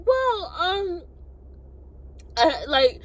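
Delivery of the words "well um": high-pitched hesitation; squeaky, unsure stalling; imitated hesitant girl. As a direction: mimic her in a high voice. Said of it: "She was like".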